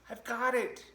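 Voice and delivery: high, loud squeal of success; triumphant pack rat. Acted. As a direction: shout it as a little rat who finally won.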